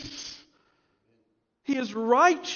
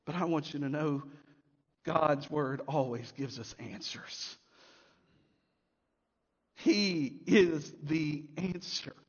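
softer, lower and slow; quiet reassurance; 8 semitones lower, 5 dB quieter than usual. Real, not acted. Pastor assuring his church that God answers, gently. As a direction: lean in and comfort the congregation.

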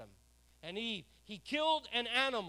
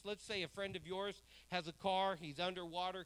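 normal talking voice

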